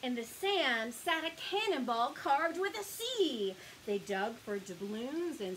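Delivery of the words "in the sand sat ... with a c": plain reading voice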